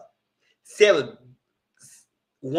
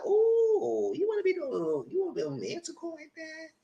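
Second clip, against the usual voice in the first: mock teasing; 7 dB quieter than usual. Teasing him for the kiss.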